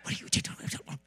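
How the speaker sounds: urgent unvoiced whisper; wife's whispered scolding in the kitchen; hissed whisper. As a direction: whisper it sharply, scolding in private. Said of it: "You're in trouble if that happens".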